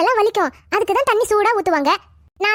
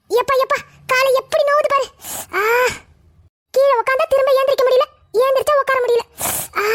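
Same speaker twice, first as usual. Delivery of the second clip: high-pitched moaning complaint of sore legs; aching groans; 5 semitones higher than usual. Acted. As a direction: groan with the effort of sitting down.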